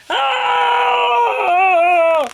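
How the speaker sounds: high, loud fleeing shriek; cats' scream. Acted. Cats running off through the graveyard, screaming high. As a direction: scream as you run. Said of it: "ran off screaming".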